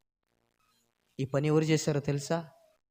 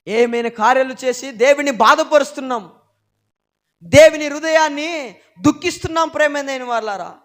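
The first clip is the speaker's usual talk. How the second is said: louder, higher pulpit preaching; fiery preaching; 10 semitones higher, 12 dB louder than usual. Acted. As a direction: drive it home to the congregation. Preaching at full force.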